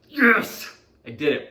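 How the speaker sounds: high loud cheer of victory; triumphant shout. Real, not acted. Dad proud he kept it together, loud cheer. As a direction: punch the air with it. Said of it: "celebrating his progress".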